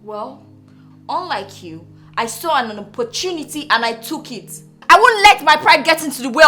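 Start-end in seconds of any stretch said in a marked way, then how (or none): 0.0-4.5 low, soft retort to a husband; quiet retort. Acted. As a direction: answer back low and restrained. Wife coolly answers jealous husband.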